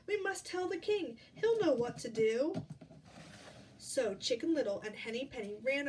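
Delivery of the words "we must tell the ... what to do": high, urgent hen; urgent storybook hen; gasped urgency. Acted. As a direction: exclaim it in mild alarm. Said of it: "she gasped".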